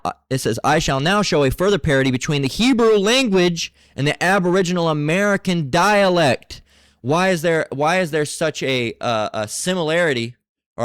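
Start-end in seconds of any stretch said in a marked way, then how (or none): 0.6-6.6 higher, louder declaimed quote read aloud; proclaimed reading. Shown as a demonstration. Preacher reading the book's claim to his listeners, declaiming loudly.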